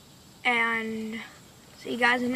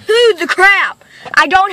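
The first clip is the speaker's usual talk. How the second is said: outraged exclaim; 6 semitones higher, 8 dB louder than usual. Real, not acted. Ranting after the call.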